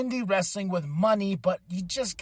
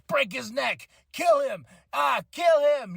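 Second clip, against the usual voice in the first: higher, louder egging-on shout; mock yell; 6 semitones higher, 3 dB louder than usual. Don Callis urging Will to finish him, shouting. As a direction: urge him on like a ringside manager.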